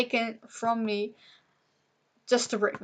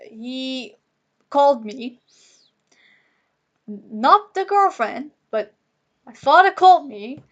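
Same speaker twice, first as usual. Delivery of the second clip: crying; 3 semitones higher, 6 dB louder than usual. Real, not acted. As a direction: push through the sobs as it wells up. Breaking down over father's call.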